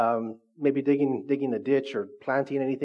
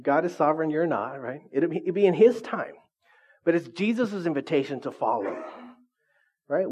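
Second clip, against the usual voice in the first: ordinary delivery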